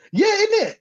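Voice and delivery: sudden very high mocking squeal; mock squeak. Real, not acted. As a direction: jump way up, mocking the outfit. Mocking a footballer's clothes.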